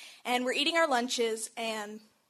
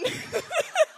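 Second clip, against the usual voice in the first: high giggle. Real, not acted. Laughing at her own swoon.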